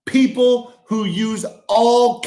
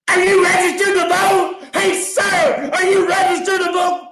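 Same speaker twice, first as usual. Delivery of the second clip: mock canvasser call; 8 semitones higher than usual. Comedian mimicking a store canvasser calling out to him. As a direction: shout it like the eager woman outside the store.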